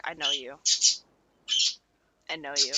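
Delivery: low, very soft character voice; quiet recognition. Retold or imitated. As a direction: say it low and under the breath. Dominic recognises the girl.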